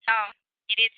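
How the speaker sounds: ordinary talk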